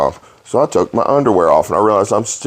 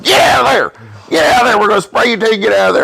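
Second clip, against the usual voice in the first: mock yelling friends; 12 semitones higher, faster than usual. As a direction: holler at him to come out. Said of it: "They're like".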